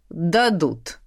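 low, assured reply; father's certainty. A narrator's reading. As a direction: answer low and unyielding. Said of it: "сощурился отец". (низко, уверенно)

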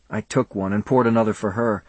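usual speaking voice

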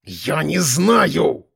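very slow, grinding answer; grating growl. A narrator's reading. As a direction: grind each word out in rage. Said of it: "проскрежетал Ананин".

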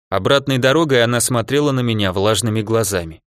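ordinary delivery, nothing marked

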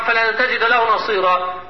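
normal talking voice

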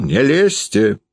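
high-pitched threat, last words drawn out; heated high-pitched outburst; angry cry. A narrator's reading. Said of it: "подскочил к Передонову и закричал".

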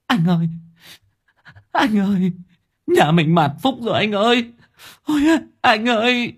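high wailing sobs to husband; sobbing. A narrator's reading. Wife sobbing to her husband about the family's ruin, high wail. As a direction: cry out to him in grief. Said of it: "chồm dậy thút thít".

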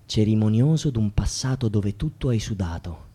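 low, soft confiding voice; subdued appeal. Acted. Speaker asking the singing worker for answers, subdued appeal. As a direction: appeal quietly, keeping the voice down.

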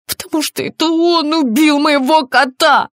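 high, loud hysterical cry; sudden scream. A narrator's reading. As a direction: burst out screaming the accusation. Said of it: "внезапно закричала она". (внезапный истошный крик)

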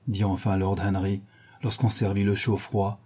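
ordinary delivery

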